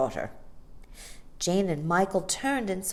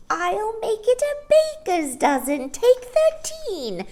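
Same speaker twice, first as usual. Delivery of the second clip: high, slow, cheerful shrill voice; cheerful old woman; 6 dB louder than usual. A narrator's reading. Old shopkeeper cheerfully offering an extra cake. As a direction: generously round up the order.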